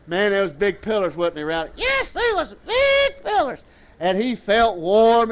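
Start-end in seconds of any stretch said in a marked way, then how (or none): 1.8-3.6 very high puppet sidekick. Pretend. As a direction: confirm it in a high, squeaky puppet voice. Puppet answers preacher's question.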